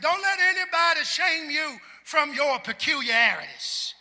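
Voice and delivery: loud, rising sermon delivery with a singsong lift; full-force preaching. Acted. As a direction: preach it out to the congregation.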